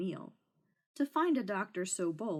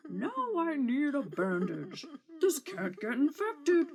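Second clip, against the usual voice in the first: higher, teary dragon voice; crying dragon; 6 semitones higher, 4 dB louder than usual. Acted. Dragon to the knight, tearful demand for a bandage. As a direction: whimper the demand like a hurt beast.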